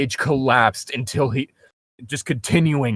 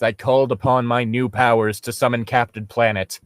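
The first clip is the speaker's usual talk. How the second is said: ordinary talk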